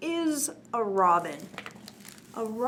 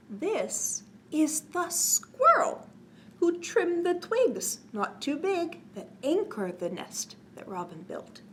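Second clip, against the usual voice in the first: sing-song rhyme read, slow, rising higher on each new line; reading to children; 5 semitones higher than usual. A narrator's reading. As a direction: bounce the rhyme for little listeners. Reads cumulative rhyme to children.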